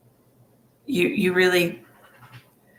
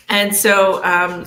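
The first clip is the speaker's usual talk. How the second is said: normal talking voice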